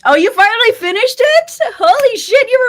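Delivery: high, loud burst of delight at the news; excited. Real, not acted. Streamer cheering a viewer who finished Wheel of Time, high and loud. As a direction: cheer her on like it's huge news. Reacting to chat friend finishing series.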